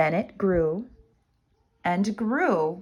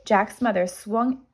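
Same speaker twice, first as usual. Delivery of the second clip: ordinary delivery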